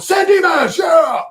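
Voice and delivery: high, loud cheer dodging the question; mock rally holler. Streamer mocks a candidate asked what he would fix, hollered idiot cheer. Mocking politician's empty answer as cheer.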